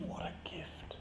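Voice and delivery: soft whisper of wonder; whispered thought. Real, not acted. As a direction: breathe it out like a secret. Recalling a tender inner thought.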